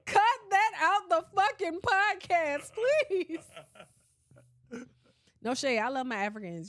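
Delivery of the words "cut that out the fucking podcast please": high, slow, drawn-out yell; loud plea. Real, not acted. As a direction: beg loudly, stretching the words. Pleading to cut the accent bit.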